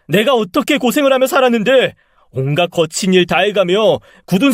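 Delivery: high, pent-up resentful outburst; angry shouting. Acted. Abandoned son shouting at his mother, angry and high. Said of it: "전 그런 엄마에게 끝까지 소리쳤어요".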